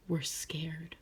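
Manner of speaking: breathless whisper; hushed frightened whisper; fearful whisper. A narrator's reading. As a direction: whisper it as if scared. Frogs frightened in flood.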